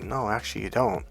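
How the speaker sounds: very soft, unmoved denial; calm contradiction. Retold or imitated. Coach contradicting insistent client, calm. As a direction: quietly shut the protest down.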